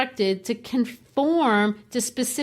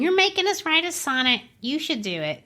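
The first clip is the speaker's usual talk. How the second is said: mock grumbling students; 6 semitones higher than usual. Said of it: "they were grumbling and saying".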